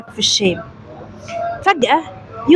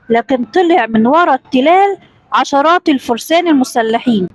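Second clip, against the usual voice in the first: ordinary delivery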